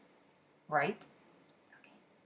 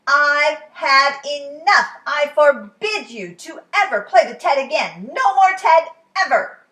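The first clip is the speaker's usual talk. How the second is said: angry father; 6 semitones higher, 14 dB louder than usual. Acted. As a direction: lay down the law, shouting. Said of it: "Father was beside himself".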